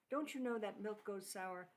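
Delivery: ordinary talk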